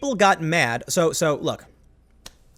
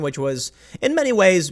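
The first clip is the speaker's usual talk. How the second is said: ordinary delivery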